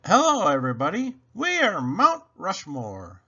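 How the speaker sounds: bright, loud group greeting in unison; mock chorus greeting. A narrator's reading. Children on stage greet the audience together, hammed up. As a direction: kids announcing together, big and proud. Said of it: "they said all together".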